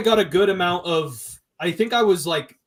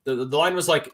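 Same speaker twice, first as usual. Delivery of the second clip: ordinary delivery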